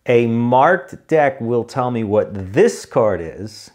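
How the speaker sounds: slow, emphatic explanation; exaggeratedly careful pace; deliberately slow. Shown as a demonstration. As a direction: say it slowly, leaning on each key word. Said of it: "You gotta talk slow sometimes".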